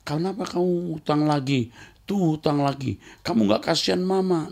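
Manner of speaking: mock scolding husband. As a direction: needle her about the debt.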